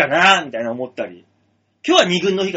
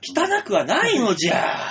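put-on slow old sage voice, raised pitch; creaky wise old man; 6 semitones higher, slower than usual. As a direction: drawl it like an ancient hermit. Plays an old man, わし/のじゃ.

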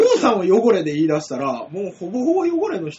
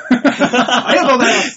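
louder thanks bursting through laughter; loud laughing thanks; 4 semitones higher, 7 dB louder than usual. Real, not acted. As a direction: blurt the thanks out while still laughing. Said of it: "こんな大声で言うことあんの？".

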